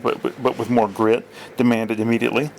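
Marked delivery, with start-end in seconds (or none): none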